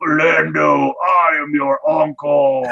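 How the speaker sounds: slow, booming monster voice from the grave; scary monster voice. Retold or imitated. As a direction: call out like a ghoulish undead uncle. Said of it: "scary monster voice".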